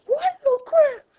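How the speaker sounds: high, incredulous mock outburst; mock exasperation. Teacher imitating herself slamming students, high mock exasperation. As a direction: pretend to be a fed-up teacher, shrieking high. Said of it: "I'm not trying to slam you by going".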